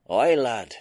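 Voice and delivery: a hearty Scots engineer answering the lad; a lightly voiced Scottish engineer, cheerful; Scottish brogue. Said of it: "in his thick Scottish brogue".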